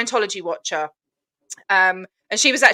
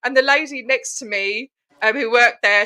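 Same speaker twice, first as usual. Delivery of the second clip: ordinary talk, nothing marked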